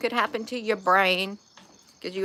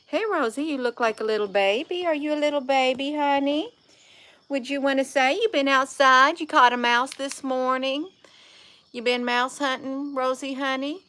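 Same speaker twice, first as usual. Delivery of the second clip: higher cooing pet voice; 5 semitones higher than usual. Real, not acted. Talking to her cat Rosie.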